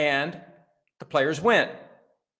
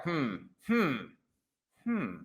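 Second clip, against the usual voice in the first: soft, spaced-out "hmm"s; pondering hum; 6 dB quieter, slower than usual. Retold or imitated. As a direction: hum as if mulling it over. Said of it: "that's when it was kinda like".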